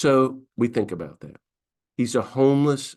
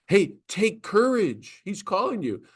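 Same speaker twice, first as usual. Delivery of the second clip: mocking; 10 semitones higher than usual. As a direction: parrot Jesus' words back with a sneer. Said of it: "They're mocking Jesus".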